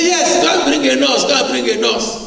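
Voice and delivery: high, lightly voiced princess granting it; a hint of a royal lady saying yes; Pharaoh's daughter answering. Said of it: "He said".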